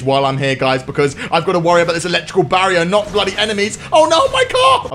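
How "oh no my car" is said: high-pitched cry of dismay; alarmed yelp. Real, not acted. Player losing control of the car, alarmed yelp. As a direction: yelp in sudden panic.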